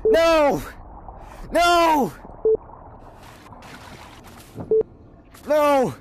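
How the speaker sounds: high, drawn-out cries; dismayed yelling. Real, not acted. Gamer losing the round, yelling in dismay.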